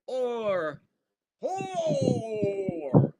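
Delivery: high stretched cry of or; mock goal cry. Commentator mock-calling a goal Tor-style. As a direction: hold the goal cry like a stadium announcer.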